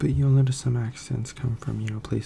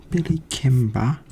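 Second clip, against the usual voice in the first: British accent; 3 semitones higher, slower than usual. Shown as a demonstration. Streamer showing off an accent to viewers, British accent. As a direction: declare it in a British accent, phrase by phrase. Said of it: "there's that British accent".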